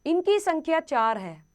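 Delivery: usual speaking voice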